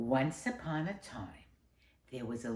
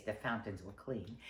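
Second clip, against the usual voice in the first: ordinary delivery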